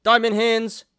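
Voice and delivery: loud, high hype-bro chant; crypto bro. Acted. Meme hype sign-off to viewers.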